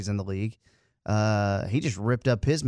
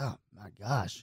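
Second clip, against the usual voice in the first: hushed dismay; 9 dB quieter than usual. Retold or imitated. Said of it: "I was like".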